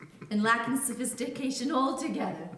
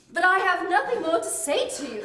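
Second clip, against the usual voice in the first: raised, higher and louder; indignant; 5 semitones higher, 6 dB louder than usual. Acted. Accused guest refuses further argument, indignant. Quarrel with fiancé's daughter.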